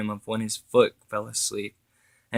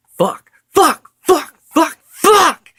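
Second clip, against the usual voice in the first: much higher, much louder repeated anguished screams; screamed; 11 dB louder, much slower than usual. A narrator's reading. Grieving grandfather punching the sink, screaming in anguish. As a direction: scream it out, each one harder. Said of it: "he screamed".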